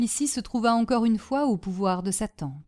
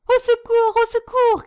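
shrill, much higher and louder scream for help; cry for help; 9 dB louder than usual. Acted. Trapped niece screaming for help, very high and loud. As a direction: scream it out in panic. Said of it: "cria-t-elle".

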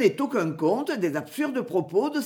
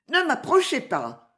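imperious; 7 semitones higher than usual. A narrator's reading. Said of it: "dit la jeune fille avec un impérieux regard".

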